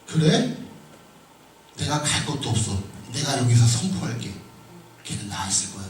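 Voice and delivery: low, quiet assurance; lightly voiced, unhurried authority; imagined Jesus. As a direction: drop it low and soft, quietly certain. Voices Jesus answering with casual assurance.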